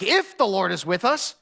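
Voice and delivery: acted exasperated protest; angry. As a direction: demand answers, pitch climbing on the questions. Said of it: "Gideon gets angry".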